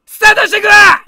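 energetic mock-angry stream intro shout; hyped shouted opener. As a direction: yell the show open like a ranting host. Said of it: "コメントすべてに切れる生配信".

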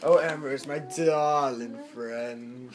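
slow stretch on "darling", pitch sinking; low, lingering "darling friend"; drawn-out darling. Shown as a demonstration. Demonstrating drawn-out villain greeting.

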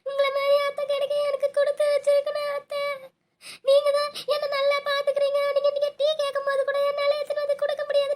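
high-pitched sobbing gratitude; tearful wailing. Acted. Daughter-in-law thanking her caring mother-in-law, crying loudly. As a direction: wail your thanks and apology through sobs. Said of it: "சரி, சரி, அழுவாத விடு".

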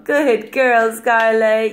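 ordinary talk

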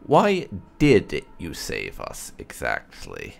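ordinary delivery

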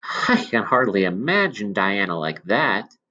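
ordinary delivery

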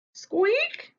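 high squeak of a word; squeaky. Acted. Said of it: "says Stink".

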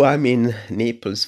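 ordinary talk

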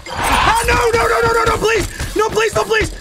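anguished denial. Real, not acted. Chased on last life.

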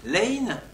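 put-on old French pronunciation shown; pronunciation demo. Shown as a demonstration. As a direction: present lane as the old pronunciation. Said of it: "au treizième siècle".

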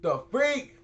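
ordinary talk, nothing marked

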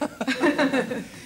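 short laugh. Real, not acted. Laughs about thicker accent.

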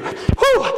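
winded exhale; breathy whew. Real, not acted. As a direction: blow out a tired whew. Coach out of breath.

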